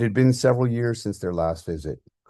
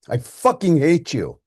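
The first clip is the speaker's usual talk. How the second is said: much higher, loud hateful yell; furious shout; 10 semitones higher, 9 dB louder than usual. Acted. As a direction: scream it and hang up. Son screams before slamming phone.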